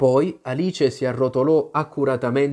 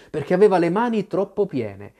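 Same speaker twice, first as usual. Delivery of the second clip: usual speaking voice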